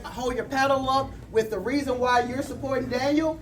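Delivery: usual speaking voice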